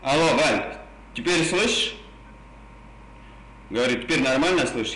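usual speaking voice

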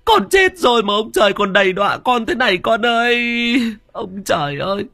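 keen over your dead son; mother wailing over her dead son's body; grieving wail. Acted. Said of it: "mếu máo".

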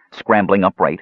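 plain reading voice

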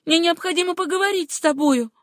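high, insistent voice; urgent demand. A narrator's reading. Acted quarrel, demanding to be let in.

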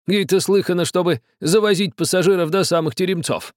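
put-on high, quarrelsome old man; grumbling. A narrator's reading. Old man grumbling at the captain, quarrelsome. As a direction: keep up the bickering. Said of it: "сварливо ответил старик".

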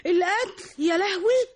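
high, loud, wailing alarm; shocked gasp. Acted. Coworker shrieks on hearing of the death threat. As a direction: cry out in horror.

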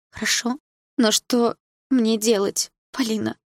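high, slow, helpless pleading; plaintive. A narrator's reading. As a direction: appeal pitifully to a friend for guidance. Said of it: "жалобно спросила она". (жалобно, растерянно)

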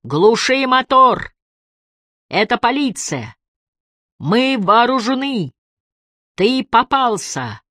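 high, slow police commands; megaphone shout. A narrator's reading. Emily warns Malik it is police, megaphone shout. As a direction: call out high, stretching the words to carry. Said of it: "снова закричала она в мегафон".

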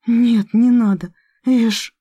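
pleading cry, slow and loud; horrified cry. Acted. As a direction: cry out in horror. Heroine cries out in horror.

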